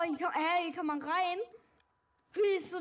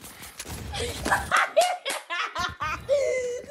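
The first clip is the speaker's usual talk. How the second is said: loud laugh; 6 semitones higher, 7 dB louder than usual. Real, not acted. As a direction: burst of high laughter. Bursts out laughing mid game.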